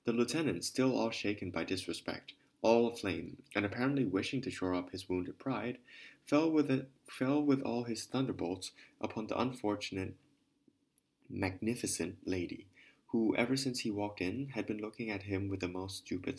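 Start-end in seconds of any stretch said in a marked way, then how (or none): none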